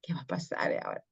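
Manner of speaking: suspenseful whisper. Shown as a demonstration. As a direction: hush to build suspense. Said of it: "Lo dejo en suspenso".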